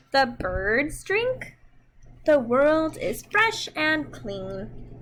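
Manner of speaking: slow, expressive storybook reading; reading to children. Acted. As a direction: read it gently to little kids, taking your time. Reads picture book to children.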